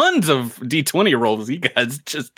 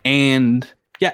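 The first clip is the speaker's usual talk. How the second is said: ordinary talk, nothing marked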